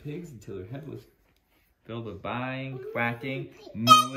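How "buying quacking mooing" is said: building loud. Acted. Animal noises read building loud.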